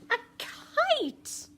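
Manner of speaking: triumphant storybook reveal; excited reveal. A narrator's reading. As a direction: announce it with wonder.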